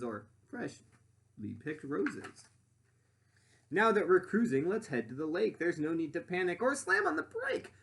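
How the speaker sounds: lively rhyming storybook read; reading to children. Acted. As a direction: read it out to the class with bounce. Reads picture book aloud.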